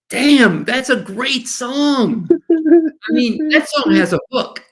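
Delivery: high-pitched rave about the song; delighted amazement. Retold or imitated. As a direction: gush like a fan just won over. Relives first hearing the song.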